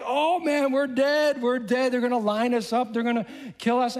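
high-pitched mock panic; acted fright, voice pitched well up; frightened astrologers. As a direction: wail it like doomed men, hammed up. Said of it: "they were frightened beyond".

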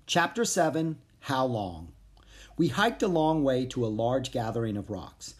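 plain reading voice

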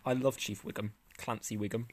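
ordinary talk, nothing marked

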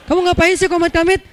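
high, loud irritated question; nagging wife. Acted. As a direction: play a scolding wife demanding an explanation. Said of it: "Istri ngomel terus".

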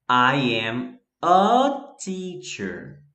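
each word drawn out very slowly; a slow teacher's model sentence; slow demo. Shown as a demonstration. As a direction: spell it out slowly for the class. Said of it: "Por que foi que eu usei o a".

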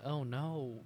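usual speaking voice

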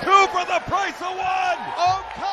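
roaring at a huge dive; excited shout. Real, not acted. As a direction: yell it over the crowd. Big dive, commentator yells.

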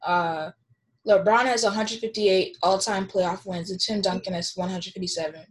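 ordinary talk, nothing marked

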